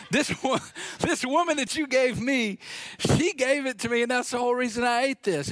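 high, mocking whine with a laugh; mock his excuse; whiny Adam. Pastor mocking Adam blaming the woman, high whine. Adam deflecting blame, mocked.

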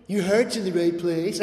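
gentle, encouraging "Your heart's in the right place"; wrong gentle reading. Pretend. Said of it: "Okay, he doesn't say that".

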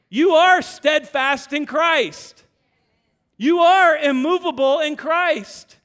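loud, slow, punched proclamation; slow emphatic preaching. Acted. Pastor declaring identity to the congregation, slow and loud. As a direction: hammer each line home.

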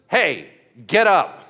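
slow, loud, drawn-out command; commanding call. Acted. Peter calling the dead woman to get up, slow and loud. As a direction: order the dead woman to rise.

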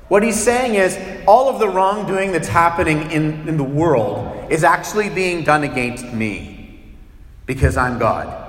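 ordinary talk

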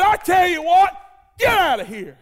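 high, loud order to leave; Jesus ordering the mocking crowd out, loud; commanding shout. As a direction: throw the mockers out with a shout. Jesus throws out the mockers.

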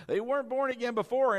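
ordinary talk

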